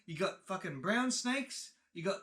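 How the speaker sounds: high, loud, sputtering mock outburst; short-tempered dad. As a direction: imitate an exasperated dad ranting in disbelief. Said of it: "short temper of him goes".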